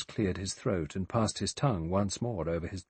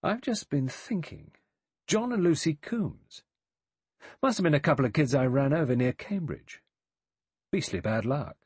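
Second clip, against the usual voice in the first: puzzled; 7 semitones higher, 4 dB louder than usual. A narrator's reading. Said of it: "in a slow, puzzled voice".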